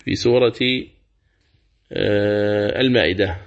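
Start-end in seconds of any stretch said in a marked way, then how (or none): none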